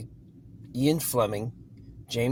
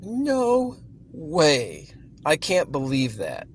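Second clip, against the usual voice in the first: higher, amazed no way; surprised disbelief; 4 semitones higher, 4 dB louder, slower than usual. Retold or imitated. Reader recalling her shock at the book's setting, surprised. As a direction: replay the jolt of surprise. Reacting to Bond in upstate New York.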